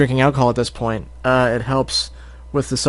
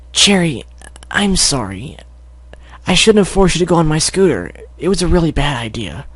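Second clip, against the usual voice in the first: high filly voice, sorry; sheepish filly apology; 3 semitones higher than usual. Acted. Scootaloo apologizing to Cherry, high sheepish voice. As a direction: apologize awkwardly as a young filly.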